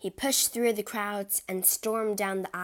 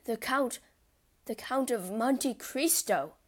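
horrified cry; 4 semitones higher than usual. A narrator's reading. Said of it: "Danglars cried".

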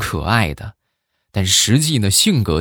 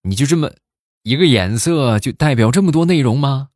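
incredulous, pitched-up question; retold astonishment; 7 semitones higher, 3 dB louder than usual. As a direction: act out being taken aback. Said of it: "我当时一听是大吃一惊啊".

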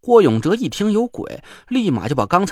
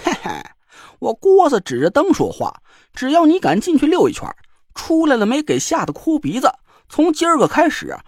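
sly dare; 9 semitones higher than usual. A narrator's reading. Joker daring the girl to enter: sly, cocky. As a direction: goad her into the tent. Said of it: "郭永哲坏笑一声".

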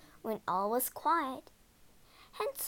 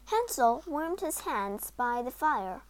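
ordinary delivery, nothing marked

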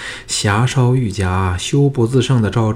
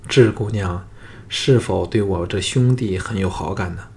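plain reading voice

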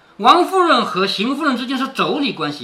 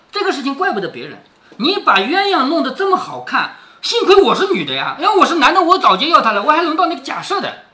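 higher, lively teasing praise; joking flattery; 6 semitones higher than usual. Acted. Wang Xifeng flattering the old lady with a joke, playful and animated. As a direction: play up the compliment as a joke, pitched up. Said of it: "又开了个玩笑".

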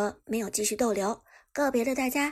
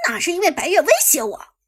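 loud, high indignant protest; angry; 7 semitones higher, 10 dB louder, faster than usual. Acted. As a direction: snap back, stung and defensive. Irritated, defending his surrender.

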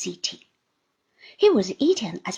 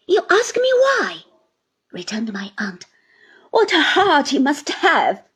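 much higher, loud indignant cry; 4 semitones higher, 6 dB louder than usual. A narrator's reading. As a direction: hurl it back at him. Aunt rebukes the man.

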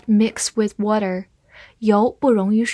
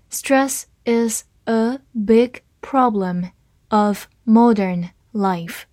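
slow, word by word; slow reading; slower than usual. Shown as a demonstration. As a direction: read it slowly and clearly for learners. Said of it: "好，慢读一遍。".

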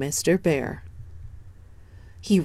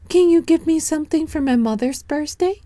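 put-on bright, much higher little boy voice; high child voice; 11 semitones higher, 3 dB louder, faster than usual. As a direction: speak up high like an eager small boy. Danny asks the cow.

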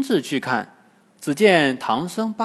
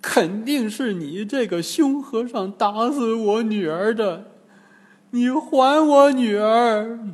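scolding; 7 semitones higher than usual. A narrator's reading. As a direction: accuse the monk. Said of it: "骂道". (高声叫骂)

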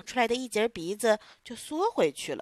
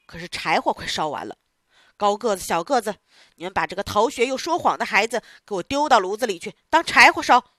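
louder, threatening command, rising to a loud end; menacing order; 8 dB louder than usual. Acted. Theatre boss ordering his men to burn Pinocchio, loud and menacing. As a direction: order them to burn the boy.

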